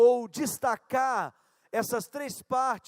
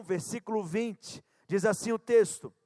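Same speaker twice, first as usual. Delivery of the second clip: normal talking voice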